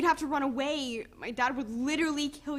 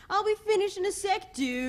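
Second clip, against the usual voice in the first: a higher, lightly mocking dude voice; mocking imitation; 6 semitones higher than usual. Girlfriend mimicking her boyfriend mid-argument, mocking dude voice. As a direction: ape the boyfriend's careless tone. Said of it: "I didn't say it like that".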